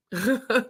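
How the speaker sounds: short wordless chuckle; a brief laugh between sentences; quick amused laugh. Real, not acted. Laughs after admitting she buys them.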